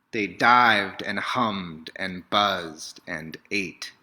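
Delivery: plain reading voice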